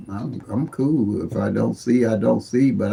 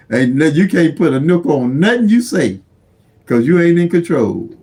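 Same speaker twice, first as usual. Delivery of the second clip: high, loud, impassioned insistence; emphatic testifying; 7 semitones higher, 5 dB louder than usual. Real, not acted. Man telling listeners nothing is set in stone, emphatic. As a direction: drive the hard-learned lesson home. Emphatic life lesson on no guarantees.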